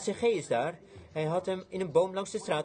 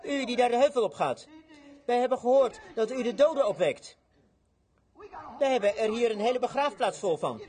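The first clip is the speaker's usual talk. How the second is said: higher, projected heckling call; acted challenge thrown out to a stranger; priest calling out; 6 semitones higher, 4 dB louder than usual. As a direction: hail someone far up the hill. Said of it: "hij hoorde de priester zeggen".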